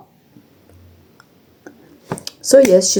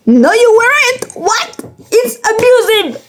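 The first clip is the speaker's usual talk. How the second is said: much higher-pitched mock protest; indignant retort; 14 semitones higher than usual. Daughter talking back to mom's excuse, high-pitched indignant protest. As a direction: cry out in exaggerated, high indignation. Acts out arguing back at mom.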